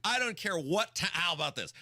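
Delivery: ordinary talk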